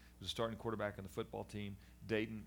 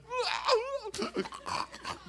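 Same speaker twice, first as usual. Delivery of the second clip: high wailing mock cry; mock sob; 8 dB louder than usual. Man imitating his own boyhood breakdown, high mock sob. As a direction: let out a hammed-up wail. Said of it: "kind of crying".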